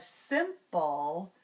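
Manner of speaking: SIMPLE slowly split into two beats; syllable by syllable. Shown as a demonstration. Teacher changing the final syllable, simple slowly split. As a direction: separate simple into sim and pul. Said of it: "change the final syllable".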